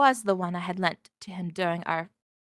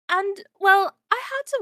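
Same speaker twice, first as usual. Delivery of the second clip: flustered; 10 semitones higher, 7 dB louder than usual. Acted. Flustered explanation to Jack.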